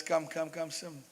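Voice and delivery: a queen drawing her husband closer in a hushed coax; coaxing murmur. Acted. Queen coaxes the king to follow her, very soft. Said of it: "She says".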